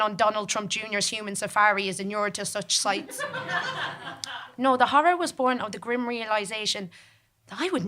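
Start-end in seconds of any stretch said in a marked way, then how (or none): 3.2-4.5 a quiet chuckle at her own joke; breathy little laugh, pitched up; amused chuckle mid-story; soft laugh. Real, not acted.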